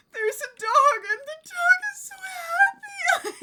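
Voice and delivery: high, loud imitation of tearful wailing; teary hammed-up wail; mock sob. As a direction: wail it like you're crying over a happy dog. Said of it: "and I'm just there like".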